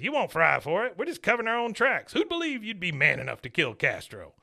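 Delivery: hammed-up villain dad; Cotton scornful. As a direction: brush him off coldly. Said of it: "Cotton says".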